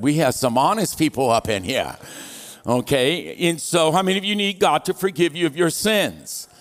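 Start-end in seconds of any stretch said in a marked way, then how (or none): none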